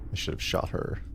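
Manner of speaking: ordinary delivery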